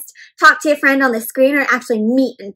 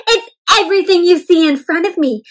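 ordinary delivery

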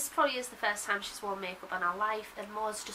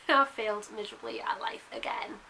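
whispering; 4 dB louder than usual. Real, not acted. Self-mocking aside after awkward joke.

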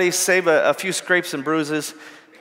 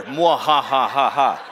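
low hammed-up villain cackle; 5 semitones lower, slower than usual. Speaker imitates a horror villain's laugh, menacing. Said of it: "a [name] at the end of Thriller".

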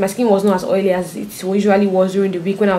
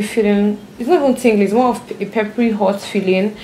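lips barely moving; talking with stiff face mask on. Real, not acted. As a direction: tight restricted mouth movement. Seen 1: Thick dried paste covers face and lips; washed off by +17.

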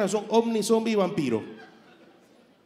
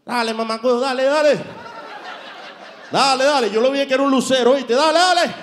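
loud, higher street call; parking attendant calling out to a driver; car-park attendant; 7 semitones higher, 5 dB louder than usual. Retold or imitated. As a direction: wave the driver in, shouting. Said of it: "El cuidacarro".

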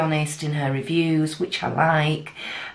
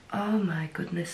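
ordinary talk

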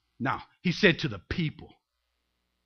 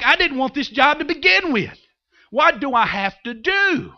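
high, pleading acted protest; exasperated Moses; 6 semitones higher, 5 dB louder than usual. Moses protesting the job he never wanted, exasperated. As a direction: throw up a frustrated complaint. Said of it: "He's so exasperated".

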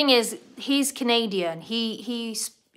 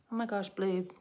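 normal talking voice

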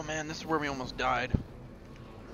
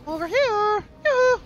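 high, loud teasing yoo-hoo; sing-song call to lure them over; 8 dB louder than usual. Pretend. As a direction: mock-friendly shout across the map. Calling out to enemies.

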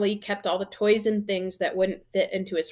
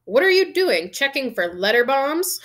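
raised, loud mocking question; shouted jibe; 6 semitones higher, 6 dB louder than usual. A narrator's reading. Uncle jokes at the boy from the kitchen, shouting. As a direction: call it out as your own joke. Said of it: "shouted Uncle Vernon from the kitchen".

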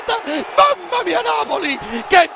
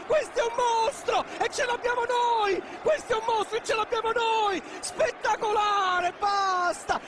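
frantic play-by-play; 10 dB quieter, faster than usual. Real, not acted. Commentator calling Matador's goal, frantic and overjoyed. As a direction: call the play then lose it completely. Live play-by-play then celebration.

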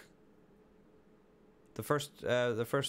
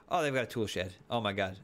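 usual speaking voice

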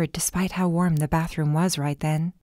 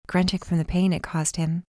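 ordinary delivery, nothing marked

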